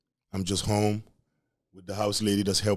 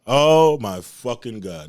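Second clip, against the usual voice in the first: loud, slow groan of dread; dramatic dread; 7 dB louder, much slower than usual. Real, not acted. Remembering mom's warning, loud slow dread. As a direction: drag it out in horror. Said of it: "dread of dad".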